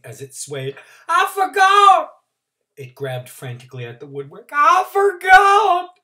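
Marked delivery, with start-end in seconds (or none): 1.1-2.1 frantic shout. Acted. Trotter frantic. 4.5-6.0 slow wail. Acted. Trotter wailing.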